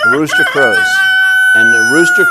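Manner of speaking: a mock rooster crowing mid-sermon. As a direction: crow it out like a rooster, very high and loud. Imitating rooster crow in story.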